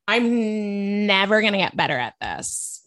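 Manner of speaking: put-on a light Valley Girl accent. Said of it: "I love your Valley Girl voice".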